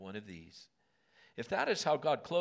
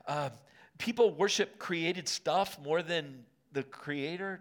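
louder impassioned pulpit voice, rising high; preaching at full force; 3 semitones higher, 5 dB louder than usual. Acted. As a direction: preach it hard to the congregation.

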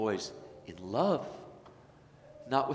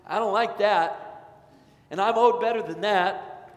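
higher, louder grumbling with a touch of mockery; mock entitled complainer; 6 semitones higher, 5 dB louder than usual. Pastor mocking an entitled churchgoer griping, whiny. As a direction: parody a churchgoer who feels owed.

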